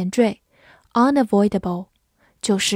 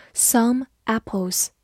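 slow, careful model reading; slow repeat; slower than usual. Shown as a demonstration. Teacher repeating a phrase for students, slow and careful. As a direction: say it slowly for the learners. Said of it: "teacher repeats slowly".

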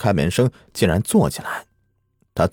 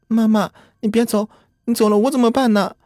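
put-on small girl calling out high and tearful; a little girl pleading for her mother to stay; child crying out. A narrator's reading. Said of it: "灵儿喊道".

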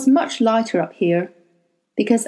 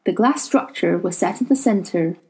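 usual speaking voice

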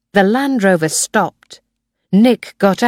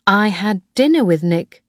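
ordinary delivery, nothing marked

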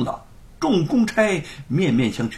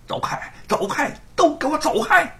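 shouted order; 13 semitones higher than usual. A narrator's reading. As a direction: shout them away, rising. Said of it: "喝道".

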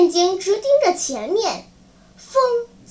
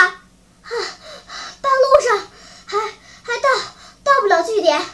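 gasping answer, high and loud; stammering for lack of air; out of breath; 6 semitones higher, 5 dB louder than usual. A narrator's reading. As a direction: pant between broken phrases. Winded boy answers, still gasping.

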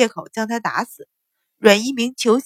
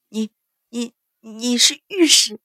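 stammering; 2 semitones higher, 6 dB louder than usual. A narrator's reading. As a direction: stammer in shock at the censor. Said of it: "结结巴巴道".

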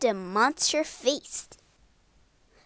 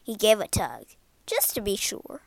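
ordinary delivery

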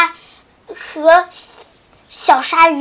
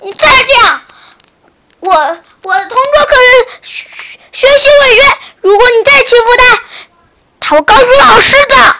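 high, loud, furious warning; stamping fury; 9 semitones higher, 6 dB louder than usual. Acted. Frog stamping his feet, warning the shark. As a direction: stamp and shout it out. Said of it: "小青蛙狠狠地跺着脚说".

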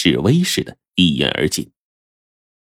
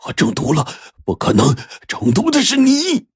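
panicked; 9 semitones higher than usual. Acted. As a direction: blurt it out in sudden terror. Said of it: "马上又惊慌失措".

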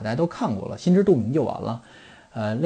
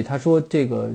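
ordinary talk

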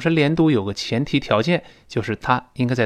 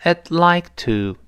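slow, careful teacher demo, linking I'd into like; teacher models a phrase slowly for repeating; slow model reading; 6 semitones higher, slower than usual. Shown as a demonstration. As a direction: spell it out slowly so students copy. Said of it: "跟老师读一下".

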